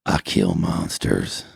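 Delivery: very low, slow deadpan; matter-of-fact. Acted. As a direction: state it flatly, deep and slow. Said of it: "in a matter-of-fact tone".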